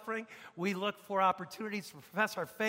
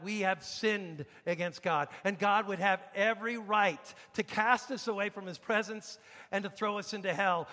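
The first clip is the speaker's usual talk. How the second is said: ordinary delivery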